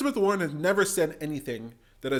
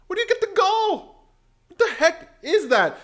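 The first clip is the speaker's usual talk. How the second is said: high, loud incredulous yell; shouting at the screen in outrage; outraged shout; 13 semitones higher, 6 dB louder than usual. Real, not acted. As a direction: bellow it in disbelief. Outraged reaction.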